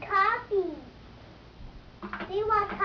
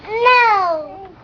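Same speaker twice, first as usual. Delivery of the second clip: loud, tearful whine; real whiny protest, close to crying; whining; 10 dB louder than usual. Real, not acted. Toddler protesting to a parent, loud whine. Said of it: "then why are you whining".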